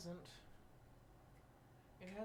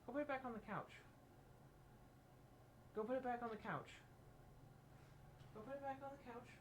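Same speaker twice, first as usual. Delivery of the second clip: ordinary talk, nothing marked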